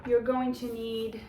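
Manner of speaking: ordinary talk, nothing marked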